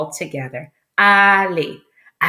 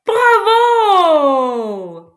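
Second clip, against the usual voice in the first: long, high, loud cheer; big cheer; 8 semitones higher, 5 dB louder than usual. Real, not acted. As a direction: shout it out and stretch the last vowel. Cheering children for right answer.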